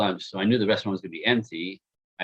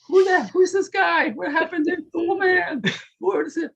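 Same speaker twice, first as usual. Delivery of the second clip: high, far-off mock voice of his panicked self. Man mocking his own panic, high incredulous squeak.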